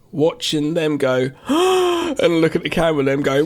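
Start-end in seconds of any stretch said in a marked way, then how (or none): 1.5-3.0 mock gasp. Gasp imitating reaction.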